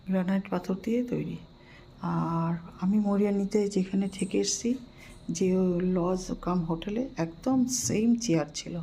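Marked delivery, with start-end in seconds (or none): none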